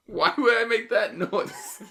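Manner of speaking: high, loud, slow through laughter; real laughing protest. Real, not acted. Host answering a friend's teasing, laughing incredulously. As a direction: protest loudly while cracking up.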